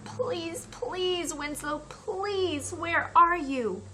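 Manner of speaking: high, pleading call for the lost dog; desperate pleading; begging. Acted. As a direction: beg the empty air, voice lifted. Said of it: "He begged the air".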